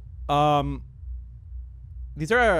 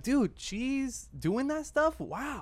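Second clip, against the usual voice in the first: impressed; 8 semitones higher, 7 dB quieter, much slower than usual. Retold or imitated. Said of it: "people would be like".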